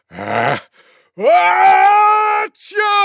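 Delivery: short "ah", then a very high shouted "achoo"; loudest sneeze. Acted. Tiger outdoing everyone's sneeze, huge screamed sneeze. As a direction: throw your head back and sneeze hugely. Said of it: "gave the loudest sneeze you could ever imagine".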